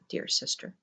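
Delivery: cooing whisper. A narrator's reading. Coaxing endearment.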